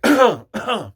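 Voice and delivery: loud, forced throat-clearing; big staged cough; loud fake cough. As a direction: hack out an exaggerated cough on purpose. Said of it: "like, cough loud".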